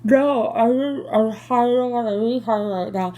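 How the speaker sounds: high, garbled yelling through gauze; mock yell with the words blurred by a stuffed mouth; imitated mouth full. Patient yelling at nurse and surgeon, garbled mouth-full imitation. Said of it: "my mouth is all full".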